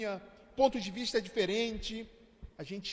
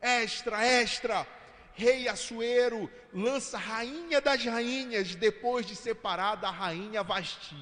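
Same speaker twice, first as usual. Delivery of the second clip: imitated street vendor, slow and drawn out; mock-shout the headline like a paper seller; newsboy's headline cry. Preacher plays a seller passing the house, crying the headline slowly. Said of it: "um vendedor passando na frente da sua casa gritando".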